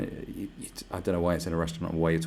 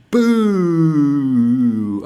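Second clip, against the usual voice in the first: long, loud, higher boo; 7 semitones higher, 12 dB louder than usual. Shown as a demonstration. Showing people how to boo China, loud and long. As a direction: boom out a jeering boo. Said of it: "Just boo for China".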